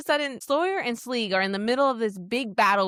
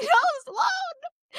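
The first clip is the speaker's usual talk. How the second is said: high pleading wail of her name; anguished cry. Retold or imitated. Fan crying out to Sloane in a high wail. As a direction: cry out to the character in dismay. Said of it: "And I'm like".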